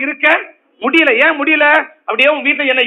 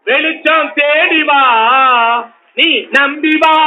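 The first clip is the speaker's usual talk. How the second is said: very slow, drawn-out chant; slow prophetic invitation; much slower than usual. Acted. Preacher inviting the afflicted to come and believe, slow chant. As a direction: call them gently toward the light.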